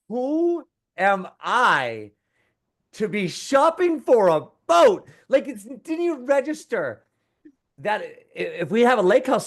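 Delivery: high, slow disbelieving question; incredulous. Retold or imitated. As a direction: ask it like you can't believe it. Incredulous self-questioning about buying boat.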